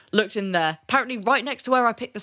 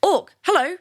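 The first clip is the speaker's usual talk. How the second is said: startled greeting; 9 semitones higher, 4 dB louder than usual. Retold or imitated.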